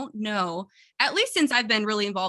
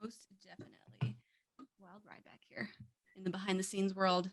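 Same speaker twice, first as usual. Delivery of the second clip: whisper; 2 semitones lower, 13 dB quieter than usual. Pretend. Whispered aside behind scenes.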